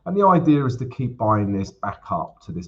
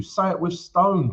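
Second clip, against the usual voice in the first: ordinary delivery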